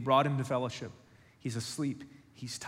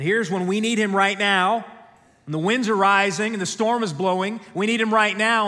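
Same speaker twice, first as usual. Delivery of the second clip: urgent; 5 semitones higher, 7 dB louder than usual. Real, not acted. Preacher voices urgent need.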